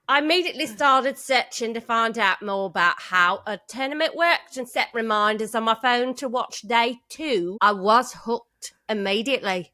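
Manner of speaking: put-on, not-quite-Cockney Swindon accent. Host continues winner's letter, amateur Swindon accent. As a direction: keep up a bad British accent while reading. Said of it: "This is my Swindon accent is what it is".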